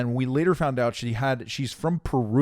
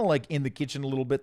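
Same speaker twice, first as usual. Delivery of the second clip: ordinary talk, nothing marked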